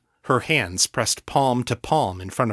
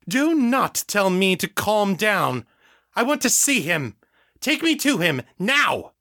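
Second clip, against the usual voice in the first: furious refusal; 10 semitones higher than usual. Acted. Mother furious at being told calm.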